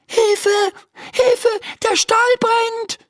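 high-pitched cry for help; alarmed calling out. Acted. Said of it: "ruft sie". (hoher, alarmierter Hilferuf)

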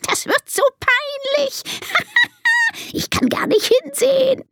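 very high voice broken by giggles; giggling monkey. Acted. Monkey expecting the elephants to embarrass themselves, high giggling. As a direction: squeal it between fits of monkey laughter. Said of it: "kichert der Affe".

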